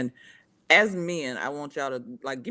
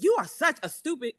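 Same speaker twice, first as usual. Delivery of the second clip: high-pitched mock yell, somewhat louder; imitated yelling; 9 semitones higher, 5 dB louder than usual. Woman yelling insults at her man, mock yell. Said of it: "if she's yelling at you".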